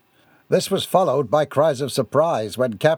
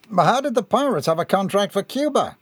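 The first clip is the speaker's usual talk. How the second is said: puzzled questioner; 7 semitones higher than usual. A narrator's reading. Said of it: "Anderson asked".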